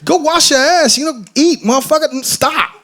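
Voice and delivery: mock tough-love rant; exasperated scolding. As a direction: snap a weeping friend out of it, half joking. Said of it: "and it's like".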